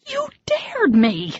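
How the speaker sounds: very high, slow accusing protest; indignant cry. Acted. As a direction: throw the blame back at him. Arguing in cold water.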